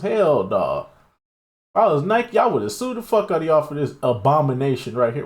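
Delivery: usual speaking voice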